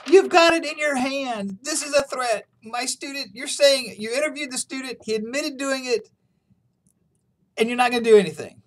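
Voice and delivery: hammed-up frantic professor; freaking out. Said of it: "freaks out and says".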